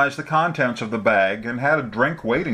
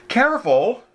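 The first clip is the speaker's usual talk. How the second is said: sharp higher warning; admonishing; 13 semitones higher, 3 dB louder than usual. A narrator's reading. Woman warning driver about braking car, sharp. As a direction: warn him sharply to watch out. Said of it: "she admonished".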